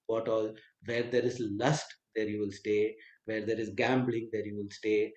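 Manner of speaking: ordinary talk, nothing marked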